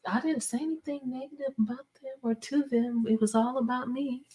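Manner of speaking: high, fast, pleading inner voice; high exasperated self-quote. Retold or imitated. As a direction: squeak it out, bewildered and fast. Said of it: "I'm like".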